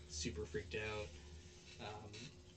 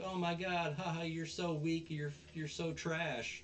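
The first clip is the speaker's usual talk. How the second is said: higher-pitched retold teasing jeer; mock taunting; 6 semitones higher than usual. Girl riling up Onizuka before the mob, mock taunting. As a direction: goad him with a mock jeer. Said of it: "trying to, like, rile him up".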